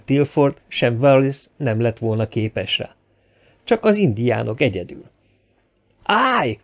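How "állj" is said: high-pitched shout to halt; shouted command. A narrator's reading. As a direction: call out a sharp order to stop. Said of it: "ordította a kezét a levegőbe emelve". (felkiáltva, parancsolóan)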